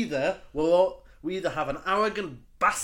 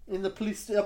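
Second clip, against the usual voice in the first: normal talking voice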